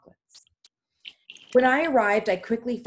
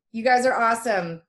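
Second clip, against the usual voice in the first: ordinary delivery, nothing marked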